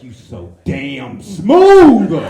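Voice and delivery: slow, loud, smooth self-congratulation; smug self-praise. Retold or imitated. Comic's heart praising his own dodge, slow and loud. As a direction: purr it, very pleased with yourself. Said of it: "in my heart, I was like".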